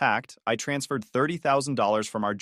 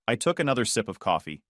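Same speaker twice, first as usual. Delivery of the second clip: ordinary delivery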